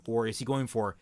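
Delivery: usual speaking voice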